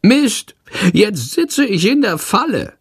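a trapped tiny bat cursing in a squeak. A narrator's reading. Shandor curses at the stuck window, high and frantic. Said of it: "flucht er".

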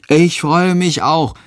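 put-on slow, high-pitched boy chiming in; agreeing child. A narrator's reading. Tom agrees with his mother about going home, agreeing. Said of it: "stimmt Tom zu".

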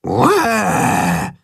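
drawn-out growly "uah"; mock dangerous roar. A narrator's reading. Tacho shows how to roar dangerously, mock roar. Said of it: "Du musst gefährlich brüllen".

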